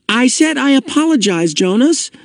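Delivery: high-pitched, exasperated insistence; irritated interruption. A narrator's reading. Boy cutting off friend's lecture, exasperated. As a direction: cut in crossly to end it. Asher cuts off Jonas irritably.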